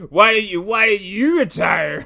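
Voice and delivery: loud, high mock demand; hammed-up yell, slowing on the repeat; mock confrontation. Acted confrontation over retiring.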